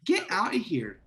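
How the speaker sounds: rising, high-pitched pleading pep talk; impassioned urging. Acted. Player urging the megalodon to escape, high insistent urging. Player urges the beast to flee.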